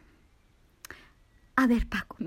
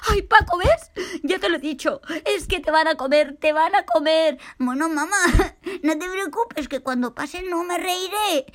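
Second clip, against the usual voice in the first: higher voice breaking into laughter; laughing through it; 5 semitones higher, 4 dB louder than usual. Acted. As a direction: scold and answer while still giggling. Diálogo entre risas. (la mamá y Paco hablan entre risas)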